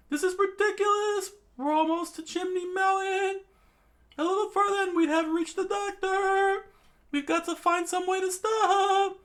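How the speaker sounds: very high, loud falsetto; squeaky cartoon cry. Kid voicing a panicked character in a squeaky falsetto.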